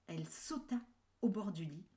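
usual speaking voice

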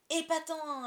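high, loud delighted cry; excited cheer. A narrator's reading. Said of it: "applaudirent John et Michael".

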